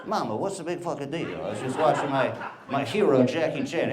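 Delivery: put-on botched Chinese accent sliding into mobster accent; hammed-up mock mobster accent; Tony Soprano. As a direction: play a kid's accent gone mobster. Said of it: "sounded more like Tony Soprano".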